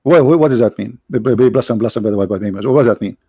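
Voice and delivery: normal talking voice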